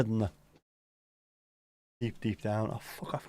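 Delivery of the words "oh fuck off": whispered under breath, annoyed; muttered swear. Real, not acted. Streamer annoyed at a song, whispered swear. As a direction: hiss it quietly to yourself.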